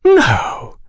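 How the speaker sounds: very high, drawn-out mock gasp of "no"; feigned shock. A narrator's reading. Oliphant pretending to be scandalised by gossip, feigned shock. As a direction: put on exaggerated shock. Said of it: "Oliphant feigned shock".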